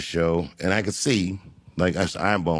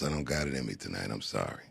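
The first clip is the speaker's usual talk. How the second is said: quiet apology; 8 semitones lower, 12 dB quieter, faster than usual. Retold or imitated. Ailing legend apologizes to crowd.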